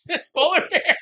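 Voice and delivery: very high, loud squeal; squealed mock excitement. Conservationists gushing over the polar bear, high squeal. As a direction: squeal it like a giddy fan. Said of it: "conservationists are like".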